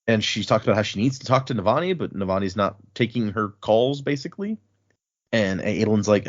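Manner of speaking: usual speaking voice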